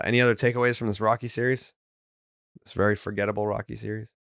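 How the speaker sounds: usual speaking voice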